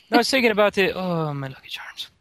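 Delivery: mock Irish, dropping to a whispery finish; Irish accent. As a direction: slip a put-on Irish brogue into small talk. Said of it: "next time I try an Irish".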